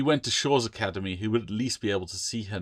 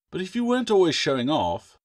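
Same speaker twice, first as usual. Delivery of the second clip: half-joking; 8 semitones higher, 6 dB louder than usual. A narrator's reading. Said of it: "She only half meant it".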